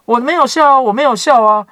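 high, loud frightened protest; Sarah caught out, denying in a high, scared voice; scared denial. A narrator's reading. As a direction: deny it in a panicky squeak, voice jumping up. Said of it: "撒拉就害怕， 不承认说".

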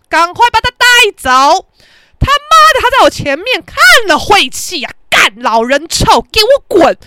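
loud, high cursing rant; angry outburst. Retold or imitated. Venting fury at the old man. (高声怒骂)

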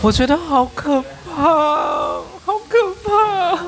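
slow, high, crying whimper; whimpering fear. Real, not acted. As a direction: whine it out slowly as if about to cry. Listener reacting to creepy ex. (带着哭腔、慢慢拉高的害怕呜咽)

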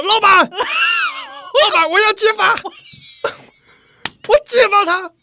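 high, loud tattletale shout; shouting informer. Pretend. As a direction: yell out like an eager informer. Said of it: "当下我应该这样喊". (高声举报)

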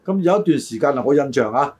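ordinary delivery

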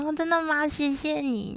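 high, bright squeal of delight; delighted surprise. Retold or imitated. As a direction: act thrilled to be asked. Delighted at massage request.

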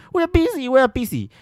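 high, curt dismissive repetition; mock busy auntie. Auntie dismissing the complaint, high curt mock voice. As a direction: brush her off impatiently. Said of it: "那个大妈就说".

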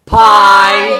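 long, loud high call; page-turning cue. Pretend. Host calls the page-turning sound, loud and drawn out. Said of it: "page turning sound".

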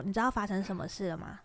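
quiet, cool retold challenge; soft and coolly controlled; cold questioning. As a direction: ask it icily, keeping your voice down. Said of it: "我就冷冷的问他说".

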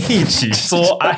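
slow, high, laughing repeat. Real, not acted. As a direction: drag it out while laughing. Teasing repeat of misreading.

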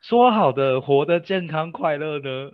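high, loud, fast mock outrage; exasperated outburst. Real, not acted. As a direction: cry out in disbelief at the broken promise. Indignant outburst at dad's switch.

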